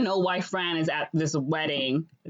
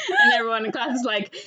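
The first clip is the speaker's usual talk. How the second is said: raised, louder laughing voice; laughing while talking; 6 semitones higher, 5 dB louder, faster than usual. Real, not acted. Storyteller amused by the dance fight, laughing. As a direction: get it out through laughter.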